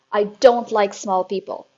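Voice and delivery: loud, booming headmistress. A narrator's reading. Headmistress lecturing the class, loud and booming. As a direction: boom it at the children like a tyrant. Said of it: "she said".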